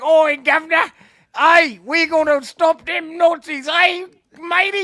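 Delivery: hammed-up British accent, high and loud; terrible British accent. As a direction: bellow it in a bad mock-British accent. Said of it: "He's just doing the worst accent".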